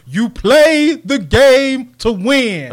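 emphatic coach press-conference voice; imitating Herm Edwards. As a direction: punch every word slowly. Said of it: "I got another one for you".

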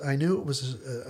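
usual speaking voice

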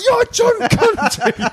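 hammed-up excited greeting. Co-host re-enacting a greeting to an old friend, hammed-up excitement. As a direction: squeal it out slowly, overdoing the starstruck recognition.

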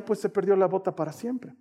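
ordinary talk

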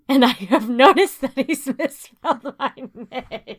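laughing through the words; giggling while telling it. Real, not acted. Discovering the misspelled name.

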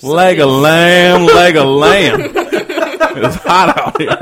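slow loud Southern gentleman drawl; old Southern gentleman. Pretend. Commodore character, slow and loud.

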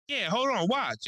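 put-on high-pitched eager old man; old neighbor. Old man about to show off his go-kart, high-voiced. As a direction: imitate an old man showing off. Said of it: "he's how he talked".

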